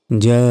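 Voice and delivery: জা held very long on the aa; four-second stretch. Shown as a demonstration. Teacher showing the long madd, জা drawn right out. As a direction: stretch the vowel of জা for four beats. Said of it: "চার second টান হবে".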